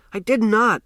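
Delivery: sudden loud high protest; shout. A narrator's reading. Boy shouting his denial at Bando. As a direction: yell it in outrage. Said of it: "I shouted".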